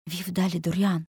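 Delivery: usual speaking voice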